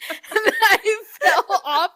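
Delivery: laughing. Real, not acted. Laughing while recounting fall.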